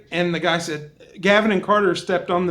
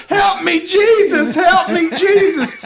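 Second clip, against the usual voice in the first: high, loud shriek for help; mock screaming; 9 semitones higher, 5 dB louder, slower than usual. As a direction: imitate a frantic woman screaming to heaven. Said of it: "then she starts screaming".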